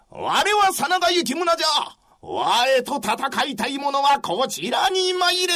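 challenging shout. Acted. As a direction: bellow to draw the enemy. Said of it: "穴山小助が".

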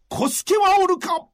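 loud high call for Kosuke. Acted. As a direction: call across the battlefield. Commander calling for his retainer.

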